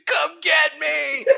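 long, high, drawn-out yell; slow defiant shout. Retold or imitated. Survivor yelling at drones, slow and high. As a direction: stretch the dare out loud. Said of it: "the guys like".

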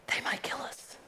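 hushed, scared whisper; fearful whisper. Acted. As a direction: whisper it so no one hears. Leaders' fearful secret aside.

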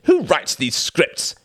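high, loud enraged outburst; furious cry. A narrator's reading. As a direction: yell the threat, fully losing it. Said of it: "cried Reginald".

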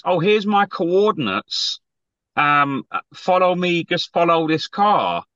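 slow, drawn-out instructions; slow mocking quote. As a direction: spell it out slowly, mocking. Mocking Campbell's texts.

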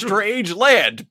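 usual speaking voice

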